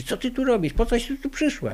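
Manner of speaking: high mock yell at an intruder; retold yelling. Friend Haneczka yelling at the unrecognized wife, high mock shout. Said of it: "pani Haneczka na nią krzyczy".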